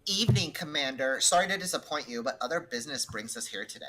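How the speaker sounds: put-on breezy Valley Girl apology; Valley Girl accent. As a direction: play her as a Valley Girl. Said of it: "I'm doing a Valley Girl accent".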